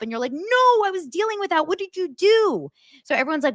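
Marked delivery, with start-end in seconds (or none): 0.3-2.7 exasperated coworker. Retold or imitated. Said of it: "doesn't listen and totally messes up".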